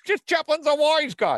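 hammed-up New York newsman accent. Host reads the New York Times in New York accent. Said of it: "That's my hook phrase".

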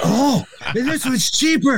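high, squealing excitement over a bargain; mock elation. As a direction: celebrate a tiny win like the lottery. Acting out joy at saving pennies.

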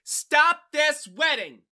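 high, drawn-out shout across a church; loud objection hurled from the back. A narrator's reading. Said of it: "a shout was heard".

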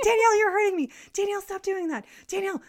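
high, loud pleading yell; high-pitched mock yell of protest; imitated sister yelling. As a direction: mimic your sister yelling to be let go. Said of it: "she's, like, yelling at me".

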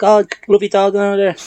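high-pitched retold coo at a dog; fond dog greeting. Retells fondly greeting supposed dog.

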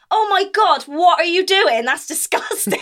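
high, loud disgusted exclaim; disgusted colleague. Retold or imitated. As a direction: recoil in disgust. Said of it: "she just went".

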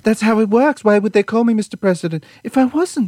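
high-pitched insistent protest; mock self-justifying president, pitched well up; Trump. Imagining a deposed president, indignantly insisting. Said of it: "He'll say".

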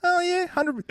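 mock casual agreement. Mocking lazy agreement.

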